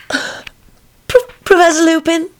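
put-on high girlish call; girl's voice. Rosie calling for the professor, high girlish voice. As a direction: knock and call shyly.

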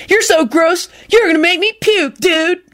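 hammed-up disgusted squeal; mock scream. A narrator's reading. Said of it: "Kenny screamed".

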